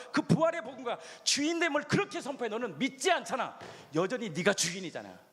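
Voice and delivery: rebuking voice. Acted. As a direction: scold them like the Spirit. Spirit rebukes unbelieving believer.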